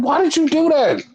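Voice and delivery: high-pitched mock dismay; freaking out. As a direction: imitate a panicked, disbelieving demand. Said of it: "freaking out".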